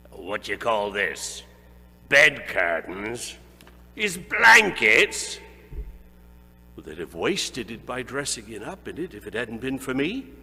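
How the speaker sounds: mock the dead man's waste with greedy glee; scheming old woman. A narrator's reading. Woman at the rag shop haggling over stolen bedding.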